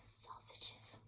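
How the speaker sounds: soft unvoiced whisper. Real, not acted. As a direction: breathe it like a secret. Whispered aside to listener.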